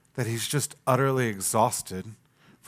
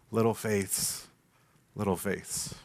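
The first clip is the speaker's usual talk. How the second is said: tender; 6 semitones lower, 8 dB quieter, slower than usual. Retold or imitated. Jesus gently naming his disciples.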